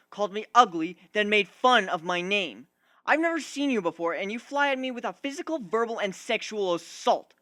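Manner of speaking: indignant retort. Acted. Griffin answering the pegasus's accusation, loud and indignant. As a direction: throw her words back at her, fuming. Griffin argues back at Dash.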